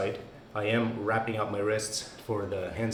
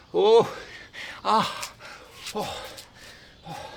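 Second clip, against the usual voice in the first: pained groans; 11 semitones higher, 6 dB louder, slower than usual. Real, not acted. Groaning after squats.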